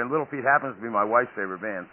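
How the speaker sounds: normal talking voice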